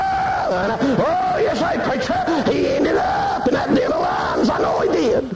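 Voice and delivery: high, shouted, laughing whoop; preaching at full force. Acted. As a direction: lift the church with joyful hollering.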